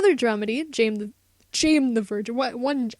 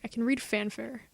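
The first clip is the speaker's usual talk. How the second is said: ordinary delivery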